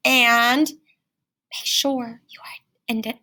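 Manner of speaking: slow unvoiced whisper. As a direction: whisper the reminder slowly. Sudden whispered reminder.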